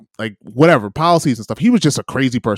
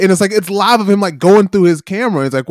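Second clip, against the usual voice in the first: normal talking voice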